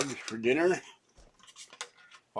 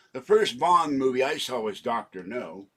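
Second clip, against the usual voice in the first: normal talking voice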